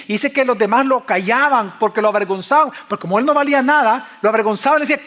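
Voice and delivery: high, loud impassioned sermon delivery; fiery preacher at full volume; full-force preaching. Acted. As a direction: drive it home to the congregation, loud and high.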